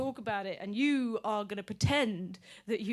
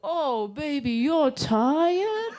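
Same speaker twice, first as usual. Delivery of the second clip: higher, louder cooing partner voice; mock-tender cooing with a laugh; cooing baby talk back; 4 semitones higher, 5 dB louder, much slower than usual. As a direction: coo back like soothing a toddler. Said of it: "they're gonna go".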